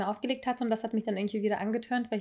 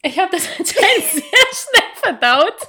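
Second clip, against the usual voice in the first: speaking through giggles, much higher and louder; laughing; 9 semitones higher, 9 dB louder, slower than usual. Real, not acted. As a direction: laugh while telling it. Laughing about her own story. (lachend erzählt)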